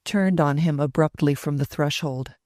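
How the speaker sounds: ordinary delivery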